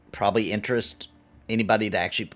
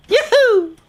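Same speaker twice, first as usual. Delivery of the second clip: high sung-out yoo-hoo; a chirpy cartoon jump cry; jump sound call; 7 dB louder than usual. Streamer proposing a southern jump sound, high yoo-hoo. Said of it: "More like".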